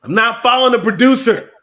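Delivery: loud, defiant refusal; defiant shout. Acted. Defiant outburst at the producer.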